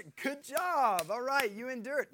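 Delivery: mock praise. Parent to a child who endured discipline, mock praise.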